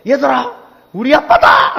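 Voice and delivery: put-on high-pitched, boastful child's shout; imitated son calling out, pitch way up; proud little boy. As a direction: announce your dad proudly to all your friends. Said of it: "그 애들 앞에서 당당하게".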